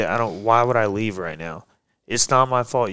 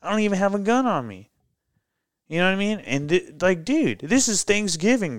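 raised, higher, pushing every line hard; retold heated arguing; 8 semitones higher, 3 dB louder than usual. Arguing with the police supervisor on Thanksgiving, heated raised voice. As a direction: plead your case hot and fast.

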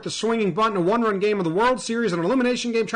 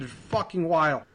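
ordinary talk, nothing marked

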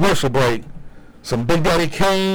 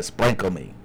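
low, soft southern twang on "Sprinkle me"; 8 semitones lower, 6 dB quieter than usual. Shown as a demonstration. Host tries the phrase with a southern accent. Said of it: "I gotta put that southern twang on there".

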